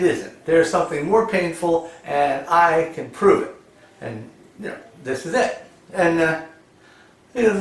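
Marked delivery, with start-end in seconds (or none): none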